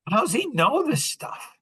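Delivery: high, hammed-up wonder; high-pitched mock bafflement; retold amazement. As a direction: ask it high, acting astonished. Retelling his amazed reaction to Tom.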